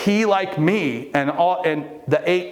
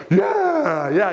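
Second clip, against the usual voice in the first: excited cheer; 6 semitones higher, 3 dB louder than usual. Retold or imitated. Group cheering John's success.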